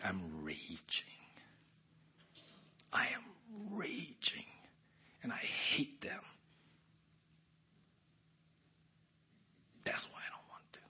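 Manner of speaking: slow, whispered confession of rage; seething whisper. Retold or imitated. Man confessing rage and hatred to God, seething whisper. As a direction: force it out in an intense whisper.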